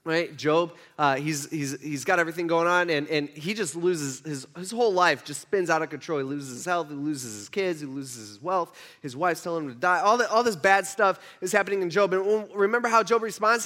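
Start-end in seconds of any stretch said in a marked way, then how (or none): none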